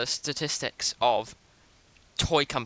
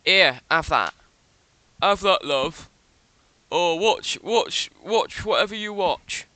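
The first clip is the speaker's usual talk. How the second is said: mock lazy parent; 3 semitones higher, 4 dB louder, slower than usual. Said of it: "parent that has given a fucking iPad".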